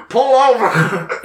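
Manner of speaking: high, drawn-out mock loudspeaker order; siren loudspeaker call. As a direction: stretch it out high like calling over a siren. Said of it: "their own little siren that they use".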